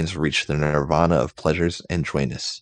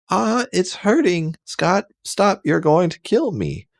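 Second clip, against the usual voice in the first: very high hammed-up cries of pain; high pained Jean; 14 semitones higher than usual. Jean in pain begs Scott to stop, very high. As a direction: wail it in a mock falsetto.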